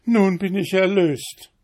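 landlady crying out her relief; relieved cry. A narrator's reading. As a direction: cry out thanks on her knees. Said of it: "fiel auf ihre Knie und schrie".

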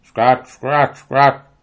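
loud, squawky duck laughter in words; loud ducks jeering; grinning ducks. A narrator's reading. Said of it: "grinsten alle Enten".

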